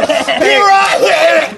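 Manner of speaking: high, loud calling out a name; shout. Retold or imitated. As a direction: yell the name in alarm. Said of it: "She was like".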